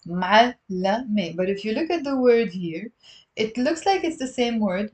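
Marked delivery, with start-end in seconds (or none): 0.1-1.4 "Ma'allame" split into m'al, la, me; deliberate "Ma'allame", each syllable set apart; three separate syllables. Shown as a demonstration. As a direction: say "Ma'allame" beat by beat in three pieces. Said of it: "It's three syllables".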